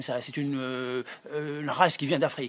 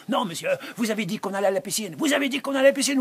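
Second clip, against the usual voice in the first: fast, high, insistent repeat; rising rapid protest; insisting; 6 semitones higher, much faster than usual. Acted. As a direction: kids shouting the promise back at him. Youths insisting to Gérard.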